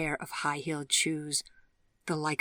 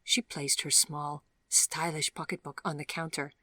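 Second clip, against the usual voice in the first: plain reading voice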